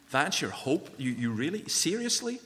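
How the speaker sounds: retold disbelief, pitch shooting up on Seriously; mock-incredulous questioning of a rival. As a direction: act out baffled disbelief, ending high. Retold incredulous retort to opponent.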